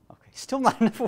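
high laughing aside. Real, not acted. Preacher laughing at his audience's silence, laughing.

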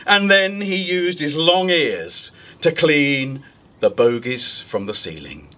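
usual speaking voice

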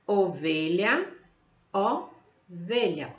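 ovelha drawn out slowly, syllable by syllable; teacher modelling the word slowly; slow sounded-out word. Shown as a demonstration. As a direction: stretch ovelha slowly for the kids. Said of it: "Olha o som".

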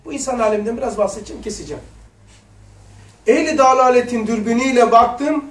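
loud, measured recitation of scripture; solemn reading. Acted. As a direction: read the text aloud with reverent weight. Resumes reading the text. (vaaz tonunda ağır okuma)

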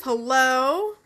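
normal talking voice

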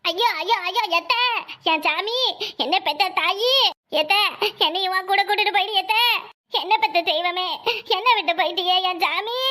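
hammed-up funeral lament; mock mourning for show; put-on wailing. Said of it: "கொஞ்சம் அழுவுற மாதிரி மூஞ்சி வச்சுக்கோ".

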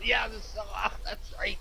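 giggling out the answer; laughing. Real, not acted. Laughing over the forgotten item.

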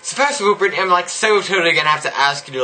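put-on high bubbly valley girl voice, every totally gushed; mock valley girl accent. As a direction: play a ditzy valley girl gushing to her friends. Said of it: "I really can't do this accent".

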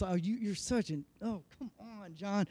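fed up with yourself, barely audible; muttered self-scolding. Retold or imitated. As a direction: scold yourself under your breath. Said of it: "I'm getting really m-, angry".

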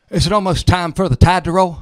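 loud, hollered question. Retold or imitated. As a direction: call out to the whole holler. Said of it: "we stick our heads out".

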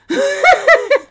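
very high, loud cackling laugh. Real, not acted. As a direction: shriek with helpless laughter. Laughs at the game.

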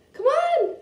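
high, loud urging cries; excited urging. Real, not acted. Girl loudly urging her toy dragon to move, excited. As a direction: push the toy to do something, loudly.

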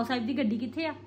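normal talking voice

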